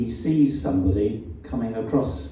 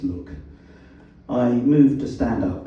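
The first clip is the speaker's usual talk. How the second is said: usual speaking voice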